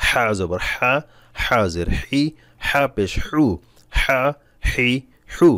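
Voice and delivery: হা হি হু squeezed from the throat; pressed throat h. Shown as a demonstration. Teacher drilling an important letter, throat-pressed h. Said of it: "গলায় চাপ দিয়ে দিয়ে পড়বেন".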